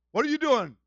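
loud, high-pitched mock alarm; alarmed demand. Patrick on the phone to the driving teacher, alarmed demand. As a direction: call out in sudden alarm.

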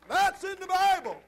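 high, loud, slow proclamation; shouted declaration. Acted. Preacher to his congregation, shouting the proof. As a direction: hammer it home at the peak. Shouted then repeated calmly.

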